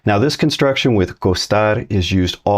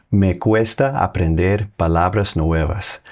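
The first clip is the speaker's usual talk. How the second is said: slow Spanish example; slower than usual. Shown as a demonstration. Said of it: "a couple more examples".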